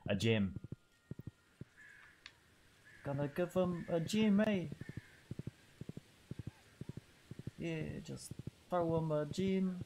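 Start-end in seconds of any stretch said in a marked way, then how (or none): 3.0-9.8 character voice. Acted. Voicing a character, far timbre.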